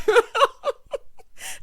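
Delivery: high, loud burst of laughter; real laugh. Real, not acted. Host teasing Gil as no expert, cackling high. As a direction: crack up loudly. Cracking up at own jab at Gil.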